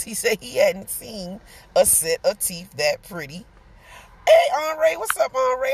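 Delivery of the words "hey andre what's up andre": cheery greeting. Real, not acted. Greeting viewer in chat.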